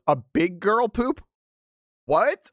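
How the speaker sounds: high, slow disbelief; incredulous. Retold or imitated. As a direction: drag it out in shock. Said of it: "The top comment is".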